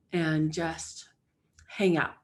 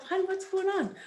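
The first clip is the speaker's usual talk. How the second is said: tender self-talk; 9 semitones higher than usual. Shown as a demonstration. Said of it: "Ask yourself".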